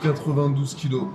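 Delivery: ordinary delivery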